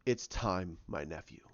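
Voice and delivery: low, soft acted voice; grave old master. Luke facing his nephew to fight, low and grave.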